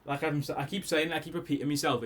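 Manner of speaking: ordinary talk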